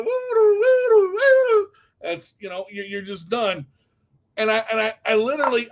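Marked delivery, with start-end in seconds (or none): 0.0-1.7 high, loud mock siren wail; siren noise. His brain sounding the alarm, mock siren. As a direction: make the woo-doo of a warning siren.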